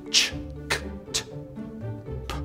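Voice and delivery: Ch, k, t, p said without voice; voiceless consonants. Shown as a demonstration. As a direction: breathe out Ch, k, t, p. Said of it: "without using your vocal cords".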